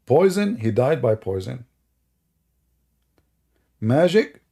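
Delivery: ordinary talk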